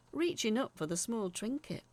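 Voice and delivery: ordinary delivery, nothing marked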